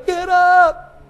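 high, loud holler of encouragement; yelling trainer. Acted. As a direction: yell the fighter up off the canvas. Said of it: "the guy's yelling".

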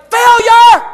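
high, loud accusing yell; condemning shout. Acted. Preacher acting out holding dad's mistakes over him, condemning shout. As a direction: hurl it out as a verdict.

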